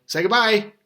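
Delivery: bright, loud sign-off prompt; cheerful prompting. Real, not acted. Prompting the child.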